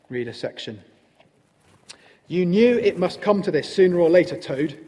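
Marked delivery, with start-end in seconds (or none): 2.3-4.8 loud, higher-pitched stern reproof; severe. A narrator's reading. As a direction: scold like a severe badger. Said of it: "the badger explained severely".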